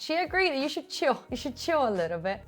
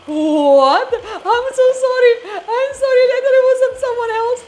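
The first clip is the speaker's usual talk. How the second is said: much higher, louder, fast, laughing; mortified apology; 12 semitones higher, 13 dB louder than usual. Retold or imitated. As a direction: gabble out a horrified sorry. Friend caught out, apologising.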